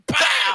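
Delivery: unvoiced, loud pow; airy mock punch noise; breathy punch sound. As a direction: spit out the hit sound. Retelling the punch moment.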